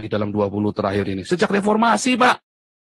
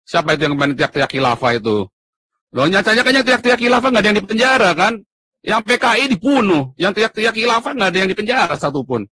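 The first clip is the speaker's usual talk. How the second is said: heated outburst; 8 semitones higher than usual. Real, not acted. Heated rant about unpunished khilafah activists.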